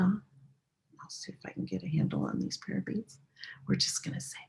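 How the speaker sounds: soft whispered aside; quiet whispered instruction. Real, not acted. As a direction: keep whispering while fiddling with beads. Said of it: "now we're gonna whisper this sound".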